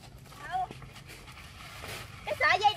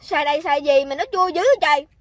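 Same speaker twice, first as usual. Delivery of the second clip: loud complaint; 10 dB louder than usual. Real, not acted. Complaining about sour mango.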